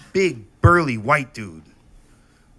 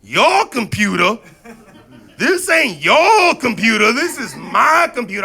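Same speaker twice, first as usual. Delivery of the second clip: mock angry mom; 9 semitones higher, 9 dB louder than usual. Mom scolding her son about the computer, angry shout. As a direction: bellow it like a furious mom.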